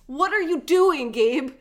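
loud, drawn-out retold scolding; mock exasperation. Retelling a wife scolding her husband Gabe, mock exasperation.

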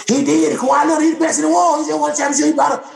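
high, hyped-up mock praise of Kawhi; mocking media hype. Mocking how the media hyped Kawhi, excited. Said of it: "The media, everybody".

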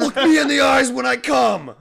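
very high, loud mock yell.

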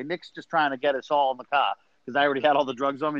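ordinary talk

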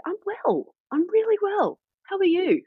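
high, bubbly, overeager reply; overly cheerful. Retold or imitated. A manager over-cheerfully answering a midwife's greeting, gushing high. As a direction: gush it out sky high to a puzzled midwife. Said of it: "stared at me like, What is wrong with you".

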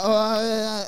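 high, inarticulate stammering; mocking awkward-kid impression.

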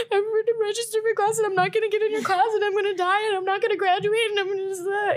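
high, fast mock panic; panicked rush. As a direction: blurt it all out in a frantic rush. Panicked reply to worried professor.

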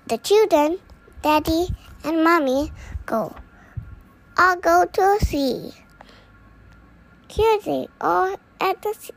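ordinary delivery, nothing marked

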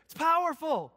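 high, loud punch; emphatic preaching. Real, not acted. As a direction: declare it with force. Repeat emphasis.

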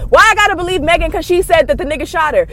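ordinary talk

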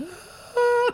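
a sudden squeaky high attempt that cracks; failed falsetto squeak; straining for a high note. Shown as a demonstration. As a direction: reach way up and let the sick voice break. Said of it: "if I were to go really high right now".

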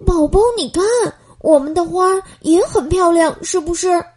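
put-on a happy little boy calling to his friend. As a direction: proudly show off the flowers. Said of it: "乔治高兴地对美宝说".

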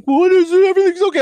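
very high, loud imitation of a woman; mock passenger lady. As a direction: squeal it as the alarmed passenger. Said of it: "and she's like".

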